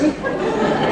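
high breathless laugh. Real, not acted. Laughing as he retells his friend's disbelief. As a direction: laugh at the memory.

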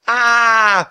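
long, high, loud scream; panicked shriek. A narrator's reading. All three fleeing the bone, long panicked shriek. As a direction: let out a sustained shriek. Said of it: "they all shrieked again".

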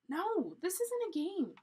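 high, loud objection; alarmed protest. A narrator's reading. Jack warns Annie it is serious, protesting. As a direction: object with urgent force.

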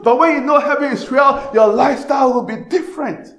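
full-force preaching. Acted. Preacher to his congregation about a faithful friend, full-force preaching. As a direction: preach it out high and loud, rising to a shout.

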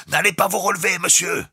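high, loud warning shout; bosun's cry. A narrator's reading. The bosun cries a warning to Jukes lying on deck. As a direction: shout a warning over the sea. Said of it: "cria le maître d'équipage".

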